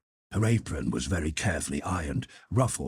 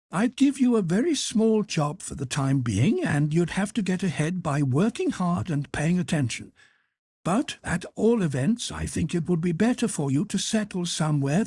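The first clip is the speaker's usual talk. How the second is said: high, brisk matronly hotel cook; head cook voice; 13 semitones higher, 3 dB louder than usual. Acted. Head cook offering Carl a job, high and bustling. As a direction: lift the pitch high for the kindly head cook.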